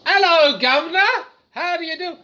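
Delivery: high, hammed-up Cockney greeting; cartoonish high Cockney accent; mock Cockney accent. Shown as a demonstration. Demonstrates silly accent stims.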